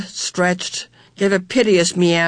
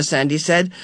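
ordinary delivery

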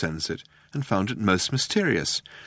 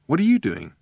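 exclaimed; 5 semitones higher than usual. A narrator's reading. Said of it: "he exclaimed".

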